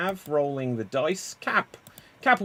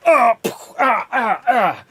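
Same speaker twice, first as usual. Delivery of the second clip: pained fight grunts; 8 semitones higher, 9 dB louder than usual. Acted. Fight scene, someone gets hit.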